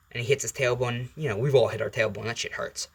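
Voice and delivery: plain reading voice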